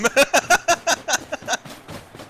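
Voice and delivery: long high cackle; high laugh. Real, not acted.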